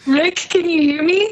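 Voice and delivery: glitchy, warbling online call audio; wobbly warbling call audio. Real, not acted. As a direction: voice breaking up over a bad connection. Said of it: "It's really, really glitchy".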